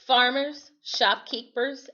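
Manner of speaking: plain reading voice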